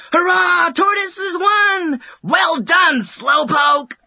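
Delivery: loud, high crowd cheer. A narrator's reading. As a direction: cheer the winner. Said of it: "the animals cheered".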